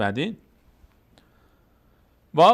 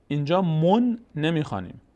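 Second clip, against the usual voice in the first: ordinary delivery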